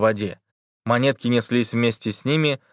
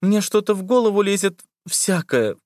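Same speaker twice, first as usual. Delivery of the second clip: put-on scared girl voice; 9 semitones higher than usual. A narrator's reading. Liza's voice continues.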